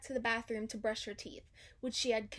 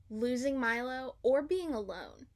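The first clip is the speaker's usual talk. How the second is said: ordinary delivery, nothing marked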